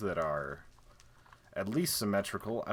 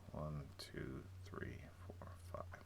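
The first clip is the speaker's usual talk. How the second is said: whispered count; 4 semitones lower, 14 dB quieter, slower than usual. Real, not acted. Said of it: "counting layers again".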